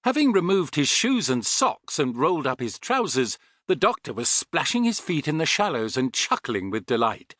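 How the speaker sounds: usual speaking voice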